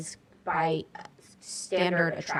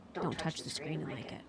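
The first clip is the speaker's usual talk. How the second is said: whispering; 3 semitones lower, 11 dB quieter than usual. Retold or imitated. Advice quoted to older guys.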